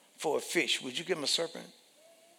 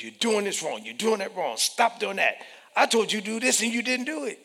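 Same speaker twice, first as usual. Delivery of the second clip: rising scolding, rushing at the end; nagging critic; 6 semitones higher, 4 dB louder, faster than usual. Acted. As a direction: scold with growing irritation.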